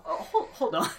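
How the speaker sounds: giggling stop-the-tape reaction; laughing double take. Retold or imitated. Retelling his reaction to the Scottish smuggler, laughing.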